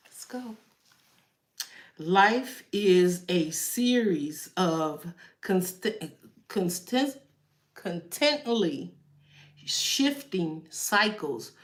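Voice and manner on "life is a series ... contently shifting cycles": slow, soft card-reading voice; unhurried oracle reading. Acted. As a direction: read the card's message slowly and calmly. Reads tarot card message slowly.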